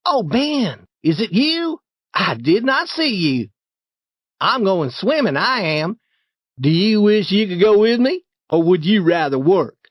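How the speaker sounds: high feigned surprise; put-on innocent surprise; mock surprise. A narrator's reading. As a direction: act as if you just noticed him. Tom feigning surprise at Ben.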